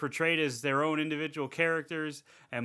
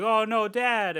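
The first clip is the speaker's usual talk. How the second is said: higher, hammed-up cry of dismay; mock dismay; 6 semitones higher, slower than usual. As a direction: imitate a son's horrified discovery, half-seriously. Acting out character finding dead dad.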